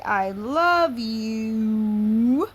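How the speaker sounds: very slow, stretched-out I love you; slow loving sing-song; tender, drawn out. Acted. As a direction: pour love into each slow word. Child voicing love to the cow.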